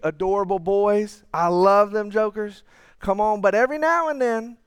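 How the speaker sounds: usual speaking voice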